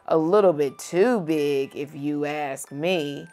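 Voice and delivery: plain reading voice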